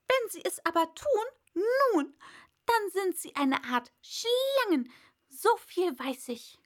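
high, loud bird voice, ranting; complaining pigeon. A narrator's reading. Pigeon ranting to Alice, high and loud. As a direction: rattle off the grievances, shrill bird.

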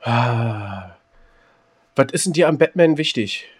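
ordinary talk

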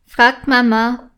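ordinary delivery, nothing marked

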